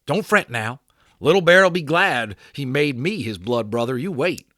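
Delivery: high, loud cowboy voice, urgent; a cowboy begging to be let in to help; Boone pleading. Acted. Boone reassures the boys.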